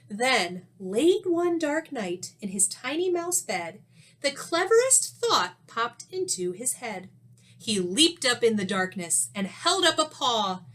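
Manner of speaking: lively storybook read-aloud with rhyme; reading to children. A narrator's reading. Teacher reading a rhyming story to young pupils, animated. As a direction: read it like a picture book to the class.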